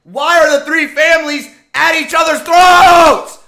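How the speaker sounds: high, loud, exasperated shouting; furious shout. Real, not acted. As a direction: yell your frustration at the plot. Outraged anime rant.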